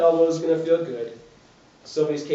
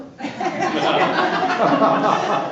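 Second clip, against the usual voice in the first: loud high burst of laughter; big laugh; 11 semitones higher, 5 dB louder than usual. Real, not acted. Laughing hard at his own answer to the client. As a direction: crack up at your own joke.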